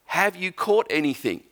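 mock shout from the beach; calling out over the lake, half-acted; retold yell. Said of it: "has the audacity to yell out this".

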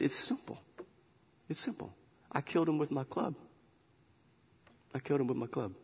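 soft, matter-of-fact small voice; lightly voiced little cub; quiet lion cub. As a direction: answer softly, plainly. Lion cub answers man.

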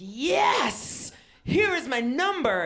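high, loud, ecstatic acceptance; overeager yell. Retold or imitated. Smitten comic handing over her phone number, overeager yell. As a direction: shout it like a smitten devotee. Acts out eager assent.

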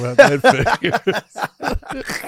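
very slow, laughing through the words; dry punchline dissolving into laughter. Retold or imitated. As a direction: drag it out while cracking up. Reacting to son fainting, laughing.

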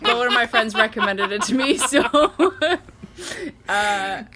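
high, loud, laughing explanation; helpless laughter breaking up the words; laughing while talking. Real, not acted. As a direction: talk through a fit of giggles.

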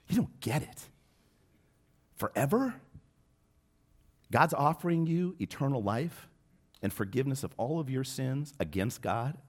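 high, pleading disbelief to his father; urgent pleading. Retold or imitated. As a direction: beg him to see it, voice climbing high.

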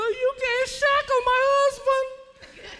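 very high, drawn-out mock wail; high-pitched blurt. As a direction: blurt it out in a squealing, slow imitation. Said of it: "Sister Terry Blood said".

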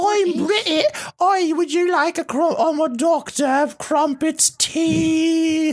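high, slow mock-British voice; terrible British accent. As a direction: overdo a silly British accent. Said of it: "her fucking British in this is terrible".

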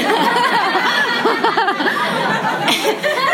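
big high laugh; real high-pitched laugh; high burst of laughter. Real, not acted. Laughing at own story.

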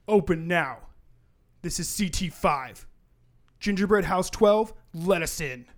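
loud demand at the door; commanding enforcer. Acted. Strike team knocking at the hideout, commanding. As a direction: order the door opened, forcefully.

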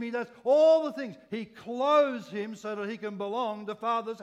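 loud, high preacher's proclamation; impassioned preaching. Real, not acted. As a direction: preach it at full force, hammering the key words. Preaches at full force.

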